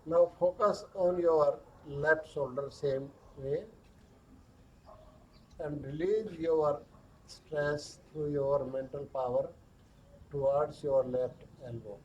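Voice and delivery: very slow, hushed body-scan voice with long pauses; guided meditation. Acted. Yoga teacher guiding stress down the arms, slow guided meditation.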